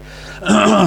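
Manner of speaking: rough throat clear. Real, not acted. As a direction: clear your throat hard. Clears throat mid review.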